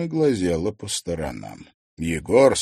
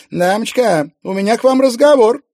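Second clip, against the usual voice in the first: high, loud confrontational call; brash drunk challenge; 11 semitones higher, 6 dB louder than usual. A narrator's reading. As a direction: step up and confront her loudly. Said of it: "заявила она".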